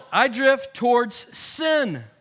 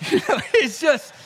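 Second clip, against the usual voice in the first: laughing; 5 semitones higher, 3 dB louder than usual. Real, not acted. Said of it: "laughing at son's apology".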